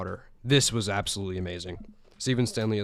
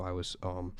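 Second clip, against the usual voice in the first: ordinary talk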